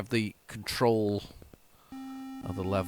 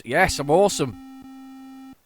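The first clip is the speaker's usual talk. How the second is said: smug boast; 7 semitones higher, much slower than usual. Real, not acted. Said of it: "I'm just call myself awesome".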